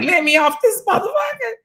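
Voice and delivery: high-pitched mock-panicked squeal; panicked plea. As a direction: wail it in mock terror, voice shooting up. Acting out panic on the ride.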